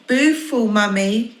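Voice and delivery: high baby coo, lightly voiced. Baby coos sweetly at her mummy. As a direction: coo it like a toddler. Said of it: "cooed the baby".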